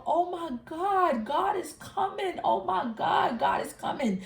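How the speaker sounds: high, tearful cry of awe, a touch acted; retold crying cry. Telling her sister through tears of awe and fear, mild tearful cry. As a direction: relive the sobbing awe lightly. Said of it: "I was crying to my sister".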